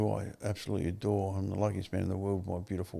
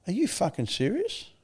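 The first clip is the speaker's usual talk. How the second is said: high-pitched incredulous challenge; retold blunt disbelief; 10 semitones higher than usual. Advisor challenging his mate, retold blunt disbelief. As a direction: question him straight, pitched right up. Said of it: "gives it to you fucking straight".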